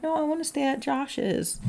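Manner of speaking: put-on high, whiny kid voice; protesting child. Child refusing a parent's call to come home, whiny protest.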